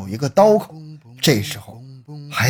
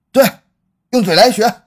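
firm order; 7 semitones higher, 5 dB louder than usual. Acted. Director repeats the order.

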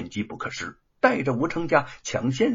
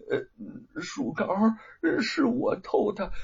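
timid confession; 9 semitones higher, slower than usual. Acted. The thief confesses at swordpoint, timidly. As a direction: own up meekly, afraid. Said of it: "胆怯地点了点头，说".

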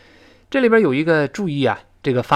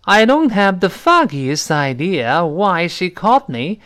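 slow, carefully articulated English reading; model sentence reading; 2 semitones higher, slower than usual. Shown as a demonstration. Teacher reads the example sentence for students, slow model reading. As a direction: read it out slowly for learners. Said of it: "是这么来说啊".

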